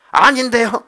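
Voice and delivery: high, loud mock protest; acted, hasty-sounding objection; protesting denial. Sarah's denial acted in a quarrel.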